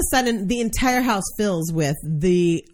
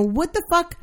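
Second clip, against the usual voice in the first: retold disbelief; 10 semitones higher than usual. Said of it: "And I go".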